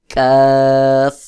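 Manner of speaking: কাফ light, with an open unrounded "aa"; thin kaf. Shown as a demonstration. Teacher contrasting it with the heavy letter, কাফ thin and light. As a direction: say কাফ thinly, as a long "kaaf". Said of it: "পাতলা করে উচ্চারণ হবে".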